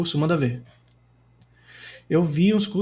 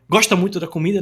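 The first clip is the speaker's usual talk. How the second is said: ordinary talk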